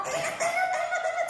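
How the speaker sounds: re-enacted high, urgent yell; retold panicked shout. Mother calling to her limp baby, retold panicked shout. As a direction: cry the name out, very high. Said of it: "Saya teriak".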